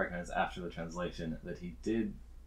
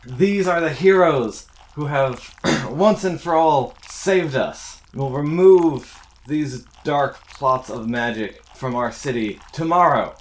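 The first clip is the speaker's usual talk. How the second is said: loud, high royal proclamation to the crowd; king's proclamation; 7 semitones higher, 12 dB louder than usual. Acted. King proclaims loudly to the cheering crowd. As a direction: boom it out like a king rallying his city.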